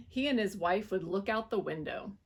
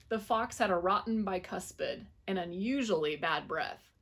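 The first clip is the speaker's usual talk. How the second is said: ordinary delivery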